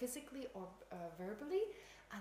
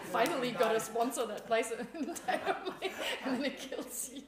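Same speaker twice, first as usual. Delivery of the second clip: joking through bursts of laughter; louder, laughter breaking into the words; real laughing; 8 dB louder than usual. Real, not acted. Joking, cracked up by own joke.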